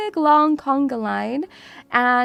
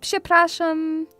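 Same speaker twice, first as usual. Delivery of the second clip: slightly higher, hesitant attempt at Polish; halting learner's Polish; 5 semitones higher than usual. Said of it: "try and speak some Polish".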